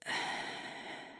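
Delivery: long, soft exhale; hesitant sigh. Acted. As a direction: sigh quietly before confessing. Hesitating before admitting a wish.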